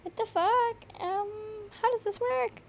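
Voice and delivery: high, loud panicked bruh; shocked disbelief. Retold or imitated. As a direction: freak out at the accidental upload. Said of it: "and I was like".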